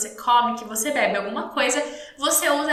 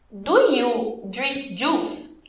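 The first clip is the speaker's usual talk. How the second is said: very slow English, words set apart; very slow model question; 2 semitones lower, much slower than usual. Shown as a demonstration. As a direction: ask it word by word for learners. Teacher models question, then repeats. (pergunta bem devagar, palavra por palavra)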